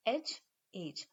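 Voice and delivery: एच and एज said very slowly; slow contrast. Shown as a demonstration. Teacher slowly contrasting the word pair. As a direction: drag out एच and then एज. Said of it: "difference आप समझ सकते हैं".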